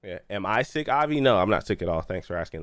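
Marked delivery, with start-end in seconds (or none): none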